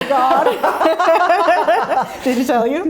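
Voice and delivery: loud laughter, high and loud; cracking up at the story; laughing hard. Real, not acted.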